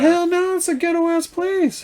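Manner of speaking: high-pitched incredulous dismissal; emphatic scoffing. Real, not acted. Driver scoffing at idea of a directory, incredulous.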